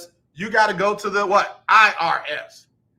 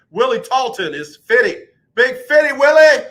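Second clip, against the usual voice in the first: higher and louder, hyped call; excited shout-out; 7 semitones higher, 9 dB louder than usual. Real, not acted. Hyping up Willie for his fiftieth, loud shout-out. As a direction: cheer his name out.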